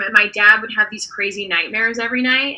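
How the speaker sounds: normal talking voice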